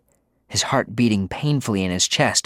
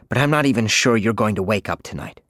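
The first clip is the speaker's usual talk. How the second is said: ordinary delivery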